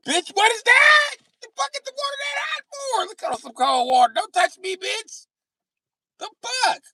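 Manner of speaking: high, loud outraged shouting; scalded screaming. Acted. Comedian acting out the burn, shouting high and loud. Acted scream at hot shower.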